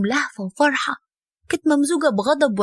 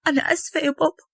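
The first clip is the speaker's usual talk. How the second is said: higher, crying daughter's voice; crying plea; 5 semitones higher than usual. A narrator's reading. Daughter crying as she begs her father to hear her. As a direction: beg her father through tears.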